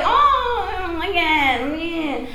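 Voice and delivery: soft, sagging whine trailing off; deflated mock complaint fading away; mock grumble. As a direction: sigh it like a fed-up churchgoer. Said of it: "we just get so like".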